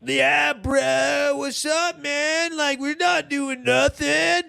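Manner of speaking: put-on high, soft, laid-back party kid; stoned kid voice. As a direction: play the chill drunk kid brushing off the cops. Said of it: "and just like".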